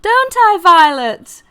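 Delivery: loud, very high flower voice; piercing high appeal for praise; demanding vain flower. Acted. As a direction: call sharply on a friend to agree. Flower demands a friend's agreement.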